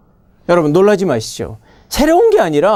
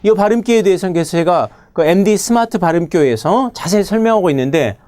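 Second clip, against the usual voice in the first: ordinary delivery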